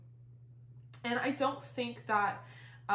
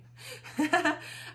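breathy laugh; 6 semitones higher, 4 dB louder than usual. Real, not acted. Laughs after reading candle text.

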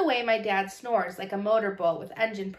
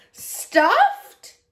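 high, loud indignant question; offended; 13 semitones higher, 9 dB louder than usual. Acted. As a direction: bristle with wounded pride. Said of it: "He sounded offended".

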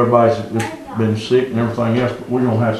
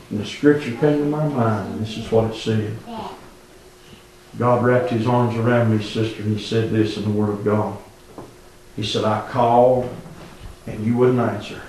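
quiet; 3 semitones lower, 7 dB quieter than usual. Real, not acted. Quiet reflective after climax.

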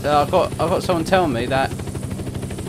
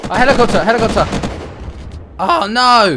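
loud, higher alarmed cry; alarmed shout; 7 semitones higher, 7 dB louder than usual. Real, not acted. Helicopter arrives during raid.

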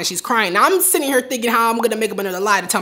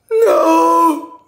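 high, loud sobbing no; crying mom; 8 semitones higher, 5 dB louder than usual. Retold or imitated. Mom's crying answer.

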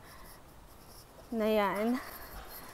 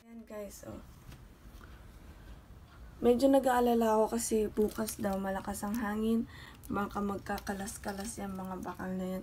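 hushed voice; 3 semitones lower, 9 dB quieter than usual. Real, not acted.

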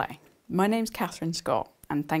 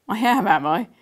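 amused; 5 semitones higher, 9 dB louder than usual. Acted. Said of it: "Dad seemed amused".

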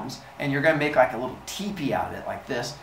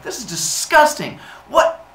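high, loud indignation; appalled, rising outburst; outraged disgust; 12 semitones higher, 6 dB louder, slower than usual. Acted. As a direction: explode in mock revulsion. Zeus revolted and shocked.

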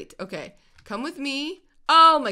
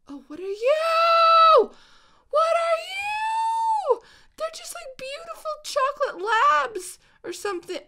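squealing excitement; 8 dB louder than usual. Real, not acted. Gamer spots new dogs, high squeal. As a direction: squeal with delight.

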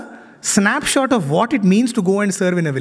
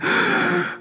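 moved gasp; 12 semitones higher, 4 dB quieter than usual. Retold or imitated. Devotee moved to tears at bhajan.

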